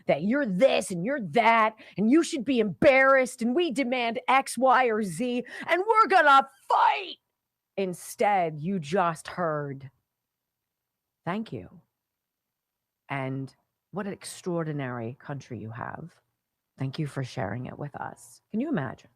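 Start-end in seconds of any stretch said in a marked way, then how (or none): 0.1-7.2 imitating a scolding, building to a high, loud cry; mock angry rant. Host mimicking news that demands and scolds, mock angry rant. 11.3-18.4 softer, lower, imitated gratitude; heartfelt thanks. Host echoing a visitor thanking America, soft heartfelt thanks. Quotes the grateful message just heard.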